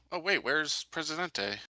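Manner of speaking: ordinary delivery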